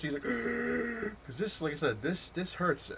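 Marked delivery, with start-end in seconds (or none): 0.3-1.2 long, high strangled screech; imitated pained scream. Man hit from a distance screaming, mimicked high screech. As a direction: squeal in comic agony, held on one high note. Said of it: "and he's screaming".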